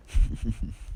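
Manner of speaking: short voiceless villain chuckle; acted chuckle. Izuku answers Bakugo's taunt, acted chuckle. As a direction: imitate a smirking little chuckle. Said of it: "chuckles, like".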